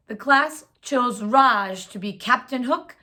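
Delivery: usual speaking voice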